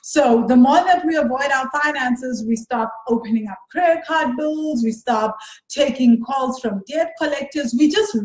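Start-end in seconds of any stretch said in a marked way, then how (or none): none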